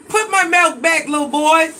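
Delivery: high, loud scolding yell. Real, not acted. Parent yelling at son to put the milk back, loud.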